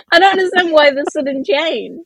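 ordinary talk, nothing marked

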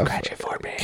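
breathy whisper. Pretend. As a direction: whisper it like a secret. Whispered hand joke.

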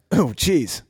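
tired voice cracking. Real, not acted. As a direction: voice cracking after clearing throat. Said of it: "my voice cracks at the end".

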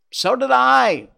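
high, loud bird screech; screeching jay. A narrator's reading. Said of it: "the jay screeched".

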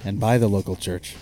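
jazz lounge radio host intro; NPR announcer voice. Pretend. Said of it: "you got that N P R like announcer voice right now".

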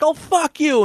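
high mock yell of retort; imitated yelling back. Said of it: "I hear this guy yelling back".

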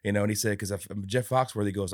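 ordinary delivery

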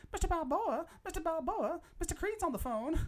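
housemaid voice. Retold or imitated. Fan imitating the housemaid calling Rocky, high mock. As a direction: call out like the maid. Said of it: "the Mexican housemaid ... she's like".

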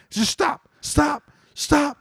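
very high, strained-up mock panic; panicked plea. Dad begs son to halt mid-walk, high panicked plea. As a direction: squeak out an alarmed command.

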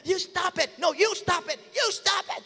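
high, shouty back-and-forth; kids bickering. Retold or imitated. Two siblings yelling at each other, high and shouty. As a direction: yell it like squabbling siblings.

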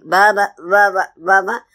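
lightly voiced cub calling upward; a touch of a small child calling mother; baby bear. Baby looks up at the mother on top, lightly voiced child call. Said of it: "baby is standing down below and she's looking at the mother".

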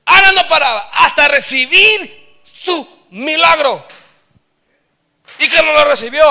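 loud, high, impassioned preaching; preaching at full force. Acted. Pastor exhorting the brothers and sisters, preaching at full force. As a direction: preach it out to the congregation with fire.

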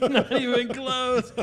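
slow laughing reply. Real, not acted. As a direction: laugh through the words.

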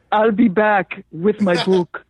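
slow, heavy Austrian accent impression; Schwarzenegger accent. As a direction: drag it out like the Terminator in an Austrian accent. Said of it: "Arnold Schwarzenegger accent".

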